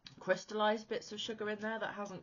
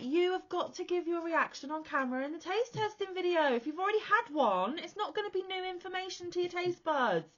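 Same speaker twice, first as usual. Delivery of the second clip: bossy scolding; 13 semitones higher, 5 dB louder than usual. Retold or imitated. Retold scolding of husband.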